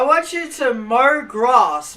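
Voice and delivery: loud, slow teasing. Retold or imitated. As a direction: tease them slowly. Teasing family while playing.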